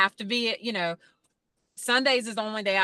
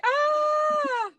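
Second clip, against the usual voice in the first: high, sudden cry of dismay; mock frantic shriek; 4 dB louder than usual. Recalling a hungry Sunday, she lets out a mock shriek. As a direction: let out a desperate little scream. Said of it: "and then Sunday I'm like".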